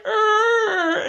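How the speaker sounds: high, loud mock screech; alien shriek. Alien Matthew answering Nancy, high loud shriek. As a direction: blare a long high "err".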